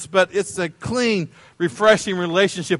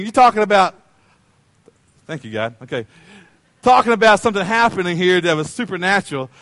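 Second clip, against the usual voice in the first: usual speaking voice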